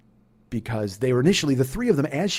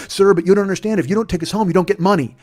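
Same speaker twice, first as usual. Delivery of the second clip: higher, louder mock pleading; insistent protest; 6 semitones higher, 7 dB louder than usual. Woman warns driver he won't get paid, insistent protest. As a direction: imitate a passenger arguing urgently.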